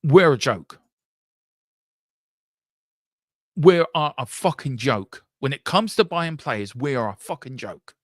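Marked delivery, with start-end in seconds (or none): 0.0-5.2 louder, bitter outburst; angry outburst. Real, not acted. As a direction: blast the club in disgust. Venting at own club.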